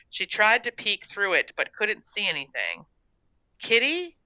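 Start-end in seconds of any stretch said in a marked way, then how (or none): 3.6-4.2 high, rising call. A narrator's reading. Gertrude calls the cat in the shed, calling. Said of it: "she called again".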